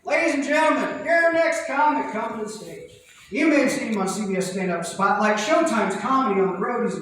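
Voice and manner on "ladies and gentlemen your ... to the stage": MC announcing. Retold or imitated. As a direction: introduce the next comic like a host. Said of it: "And he's like".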